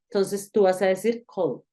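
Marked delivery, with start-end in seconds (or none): 1.3-1.6 "called" with a soft final D; soft ending after L. Shown as a demonstration. Teacher models the word ending in L, soft final D. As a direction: say "called" ending gently on a D sound. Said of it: "va a sonar un poquito más suave".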